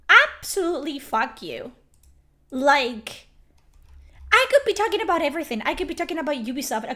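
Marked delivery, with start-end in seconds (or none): none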